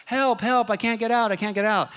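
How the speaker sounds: high, hammed-up cries of help, mocking the helpless guy; mock panicky cry for help. Preacher mocking the guy trapped in a sinking car, mock panic. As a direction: play a trapped driver pleading in mock panic. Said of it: "I'm not gonna be the guy going".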